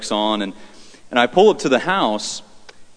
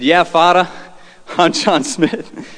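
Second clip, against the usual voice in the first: imitating an Eastern US accent, louder and higher; old man's loud Eastern-accented greeting; 6 semitones higher, 4 dB louder, slower than usual. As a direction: put on a punchy Eastern accent. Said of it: "He had this Eastern accent".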